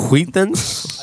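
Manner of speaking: "Wheat Thins" with a puffed hw on "Wheat"; extra h sounds. Retold or imitated. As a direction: imitate the ad, breathing hard through the H of "Wheat". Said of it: "why are you pronouncing the H so much?".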